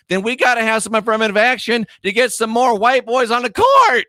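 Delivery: high, indignant, building to a yell; sarcastic demand. Real, not acted. Turning the argument round, sarcastically.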